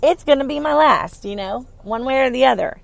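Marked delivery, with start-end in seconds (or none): 0.0-1.3 playful announcement. Retold or imitated. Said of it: "I'm gonna say".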